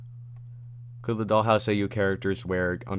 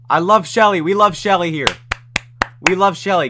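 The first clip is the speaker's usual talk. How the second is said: higher, louder fan cheer; excited cheering; 8 semitones higher, 7 dB louder than usual. Real, not acted. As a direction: hype up the crowd. Cheering for Shelly on stream.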